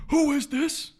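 high, loud angry caller; screaming customer. Retold or imitated. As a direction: shriek it down the phone at the clerk. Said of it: "Screaming. Immediate screaming".

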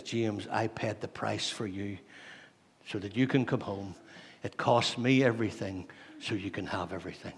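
soft, low and measured; quiet, solemn sincerity with pauses; tender reassurance. Acted. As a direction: speak gently as one who paid for a brother. Jesus tenderly reassures his brother James.

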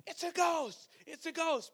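yelling in fear. Retold or imitated. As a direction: shriek it like the terrified disciples. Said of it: "they're yelling".

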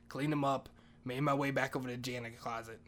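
normal talking voice

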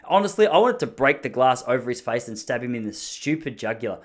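ordinary delivery

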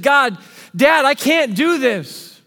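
high, loud and slowed cry of despair; anguished plea. Acted. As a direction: pour out anguish, drawing it out. Jesus pleads before the cross.

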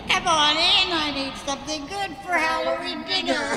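witch voice. Pretend. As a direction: lure them in like a wicked witch. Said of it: "can we get a witch laugh for the podcast".

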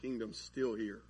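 low, soft confiding aside; quietly in their ear. Acted. Disciple easing over to a non-listener's ear, murmured low. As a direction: ease in close and murmur it low. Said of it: "just ease over to their ear and go".

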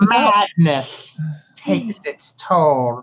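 very slow, soft, ominous intoning; slow eerie intoning. Acted. Pale host intoning the lyric at the guests, slow and ominous. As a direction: drag it out like a doom-laden chant.

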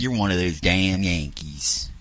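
low, slow, quiet Southern drawl; hushed country drawl; imitated Southern local. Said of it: "you sound like you're impersonating".

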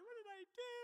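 sudden high panicked squeak; a scared kid wondering what he did wrong. Retold or imitated. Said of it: "and I'm like".

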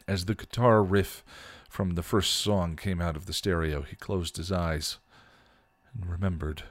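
steady read of a fan story, voicing the characters lightly; story narration. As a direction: read it out like an audiobook chapter. Reads a fan story aloud.

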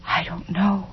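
soft, lost admission; helpless murmur. A narrator's reading. Tormented mother answering her child, soft and helpless. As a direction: let it fall away quietly.

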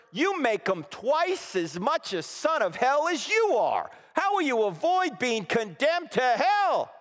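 loud, slow, high thundering rebuke; stern rebuke. Acted. Preacher voicing Jesus rebuking the Pharisees, loud and stern. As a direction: thunder it like a prophet condemning hypocrites.

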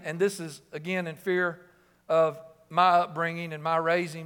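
ordinary talk

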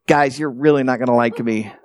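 low-pitched, lightly acted confession; sheepish. Guy confessing a third failure, sheepish. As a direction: imitate someone bracing the group for bad news.

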